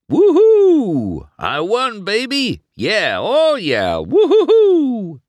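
very high, loud, drawn-out whooping; cocky racer whooping over the win; gloating whoop. A narrator's reading. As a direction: crow over the win like a brat. Chick gloats winning.

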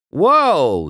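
loud, high cry of protest; alarmed call. A narrator's reading. Racer to crewman rolling off, calling out. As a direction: call after someone leaving. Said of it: "he called as the pity rolled off".

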